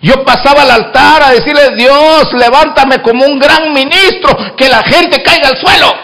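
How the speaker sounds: impassioned preaching, pushed up high. Acted. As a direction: preach it out to the congregation. Preaching at full force. (predicando con fervor)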